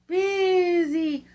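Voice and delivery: the wind's voice, high and loud; eerie moaning wind chant; howling wind. A narrator's reading. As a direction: howl it like a storm wind through the chimney. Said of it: "the wind blowing".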